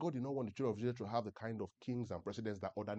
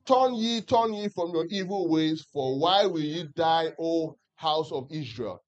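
slow, much higher and louder plea; higher, louder scripture plea; 6 semitones higher, 12 dB louder, slower than usual. Acted. Preacher and church reading the prophet's plea aloud, higher and louder. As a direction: call it out like a pleading prophet.

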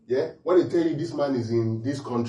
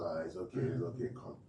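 put-on much softer, lower hushing to a child; soothing parent; 9 semitones lower, 14 dB quieter than usual. Parent comforts a crying child, tender hush. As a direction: coax a crying child softly.

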